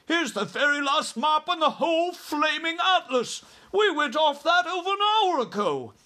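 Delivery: very high-pitched, frantic rant; exasperated shouting. A narrator's reading. Air Force chief showing the atlas to army head, frantic shouting. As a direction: shout it all out, frantic and shrieky. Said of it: "cried the Air Force man".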